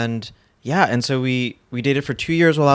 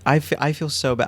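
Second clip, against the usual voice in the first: usual speaking voice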